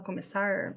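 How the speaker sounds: "começar" ending in a drawn-out American-style R; "começar" with a retroflex R, "começarr"; other regional R. Shown as a demonstration. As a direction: curl and hold the final R of "começar". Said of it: "tem gente que fala".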